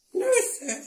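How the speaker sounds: very high, squeaky brothers voice; brothers' voice. A narrator's reading. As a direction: pitch way up for the brothers.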